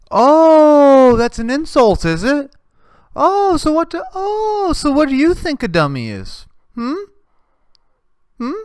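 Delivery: answering the insult, loud and indignant; indignant outburst. Acted. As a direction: fire back, outraged and shrill-high.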